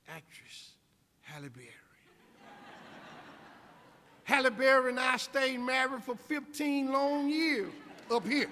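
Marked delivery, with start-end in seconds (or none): 4.2-8.5 loud, high-pitched proud proclamation; emphatic shout. Acted. Speaker boasting of his imagined marriage, loud emphatic shout. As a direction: belt it out to the whole crowd.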